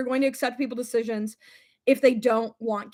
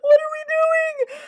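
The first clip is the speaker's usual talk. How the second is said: high, slow, loud laughing wail; real laughing shriek; 5 dB louder, slower than usual. Real, not acted. Laughing in disbelief.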